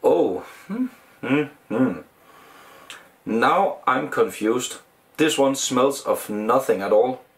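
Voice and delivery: glass held to lips; sniffing drink while talking; speaking into a glass. Real, not acted. Seen 3: Glass held against masked mouth and nose throughout, sniffing drink.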